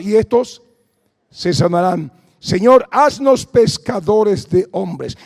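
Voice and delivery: loud fervent scripture reading; preaching. Acted. Preacher proclaiming Jesus' commission, fervent.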